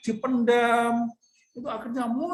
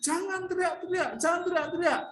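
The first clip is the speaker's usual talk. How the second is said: higher hushing rebuke; scolding; 8 semitones higher than usual. Acted. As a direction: shush him sharply. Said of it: "dimarahi oleh banyak orang".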